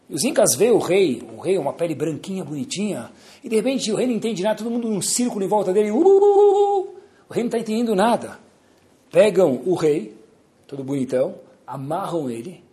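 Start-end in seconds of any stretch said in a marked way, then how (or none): 5.9-6.9 high, loud whooping chant. Acted. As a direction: whoop in a circle like a tribe. Tribe chanting around king.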